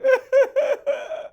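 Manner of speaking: high, loud laugh; real burst of laughter. Real, not acted. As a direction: break up laughing. Reader laughs at baby cry.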